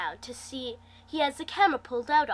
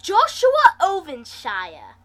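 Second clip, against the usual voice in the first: hiss; 4 semitones higher, 9 dB louder, slower than usual. Acted. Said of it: "I hiss".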